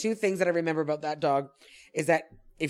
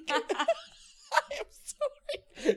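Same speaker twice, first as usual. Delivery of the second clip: laughing apology; 5 dB quieter, much slower than usual. Retold or imitated.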